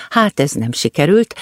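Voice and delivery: usual speaking voice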